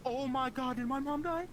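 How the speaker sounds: high-pitched mock panic over mom; retold panic. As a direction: relive the instant fear that mom died. Panicked reaction to news of mom.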